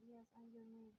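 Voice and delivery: hushed whispered reply. A narrator's reading. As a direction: whisper it back gently. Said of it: "whispering back".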